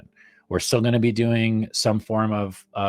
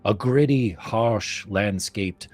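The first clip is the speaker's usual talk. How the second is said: story narration; 4 semitones higher than usual. Acted. Reads campaign blurb aloud.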